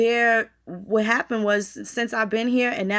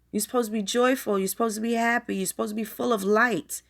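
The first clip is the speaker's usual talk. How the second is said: ordinary talk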